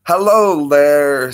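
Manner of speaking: very slow, loud hello there; drawn-out greeting. Real, not acted. Welcoming viewer Spina Bifidus with a slow, loud, stretched hello.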